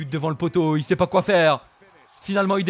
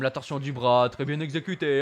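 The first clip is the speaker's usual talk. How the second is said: ordinary delivery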